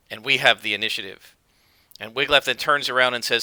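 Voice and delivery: ordinary talk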